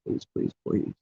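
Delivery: acted desperate pleading; mild begging, trailing into repeated pleas. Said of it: "start going on the street and begging".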